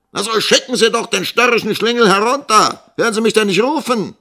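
high, loud, impatient yelling; angry order. A narrator's reading. Schoolmaster demanding his assistant send the boy, angry yelling. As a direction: yell the order, quickening at the end.

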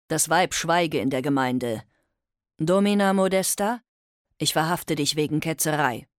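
loud, spitting contempt; contemptuous. Acted. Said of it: "Er spie der Domina seine Verachtung entgegen".

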